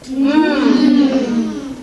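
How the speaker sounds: Mm hummed with lips closed; m sound. Shown as a demonstration. Teacher demonstrating the m sound with lips closed. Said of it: "can you make the sound".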